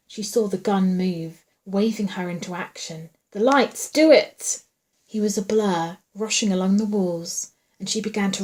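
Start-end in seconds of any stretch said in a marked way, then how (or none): 3.3-4.6 high, loud, urgent order; urgent command. A narrator's reading. Man waving her into action, urgent command. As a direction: push it out sharply and louder.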